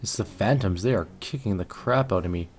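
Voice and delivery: normal talking voice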